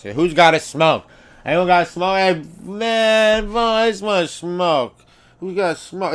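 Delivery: hammed-up slurring cadger after smokes; drunk begging. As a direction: drunk at a party asking everyone for a cigarette. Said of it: "You know the eye?".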